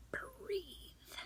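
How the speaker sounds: breathy whisper of the product name; hushed, reverent whisper. Shown as a demonstration. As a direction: whisper it like a secret.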